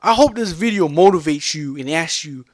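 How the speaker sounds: normal talking voice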